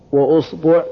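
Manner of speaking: "wa-usbu'", u before and after the sb; damma on both. Shown as a demonstration. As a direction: say وأصبع as "wa-usbu'", u in both syllables. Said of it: "ضم الهمزة". (وأصبع بضم الهمزة وضم الباء)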